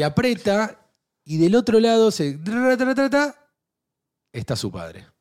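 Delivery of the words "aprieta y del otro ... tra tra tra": higher and louder drumroll, climbing; building suspense. Acted. Storyteller builds to the twist, rising loud drumroll.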